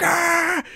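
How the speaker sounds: high-pitched mock cry of pain; imitated scream. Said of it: "But he's screaming".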